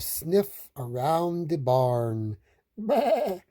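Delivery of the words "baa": high, wavering baa; high quavering sheep call; sheep bleat. A narrator's reading. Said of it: "say the sheep".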